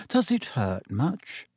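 slow, sugary simper. A narrator's reading. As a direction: coo it with fake concern. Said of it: "simpered Pansy Parkinson".

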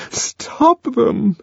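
high begging repeat; pleading high cry; imploring. A narrator's reading. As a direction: plead again, high and desperate. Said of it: "she implored".

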